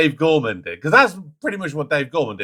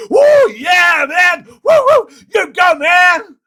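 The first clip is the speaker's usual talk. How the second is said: hammed-up whoops, much higher and louder; mock American cheering; 10 dB louder, much slower than usual. Mocking overexcited American audiences with wild cheering. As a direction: cheer wildly at a terrible answer. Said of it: "you could say".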